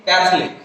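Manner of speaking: ক্যাথলিক run together as kæth-lik; ক্যাথলিক in two syllables, falling; two-syllable pronunciation. Shown as a demonstration. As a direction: say ক্যাথলিক with no o in the middle. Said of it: "উচ্চারণ হবে কি?".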